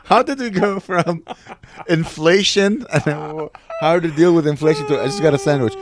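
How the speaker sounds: high, loud, incredulous questioning; baffled and cracking up; amused disbelief. Real, not acted. Friend calling out a jump from inflation to sandwiches, incredulous.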